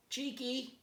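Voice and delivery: high calling voice. A narrator's reading. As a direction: call his name up into the tree. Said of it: "called out".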